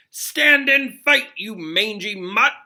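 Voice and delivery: high, slow, loud knightly bellow; pompous knight yelling at a boy; yelled challenge. Acted. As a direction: roar a challenge like a mad old knight. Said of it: "yelled Sir Cadogan".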